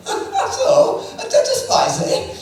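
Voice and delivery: high, drawn-out mocking surprise; mock sarcastic student. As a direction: pretend to be impressed while teasing. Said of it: "the good student".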